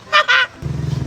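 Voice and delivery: high burst of laughing; put-on high cackle; laughter. Adult laughs after musing aloud.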